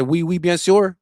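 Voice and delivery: put-on tossing off French with a playful French accent; mock French. Joking that he speaks French, mock French accent. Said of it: "unless you speak in French".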